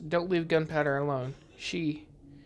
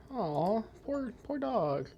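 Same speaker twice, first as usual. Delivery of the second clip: slow, higher, softer poor, poor dog; gentle cooing sympathy; tender pity; 5 semitones higher, 5 dB quieter, much slower than usual. Real, not acted. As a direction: coo over the poor dog. Pities a lonely dog.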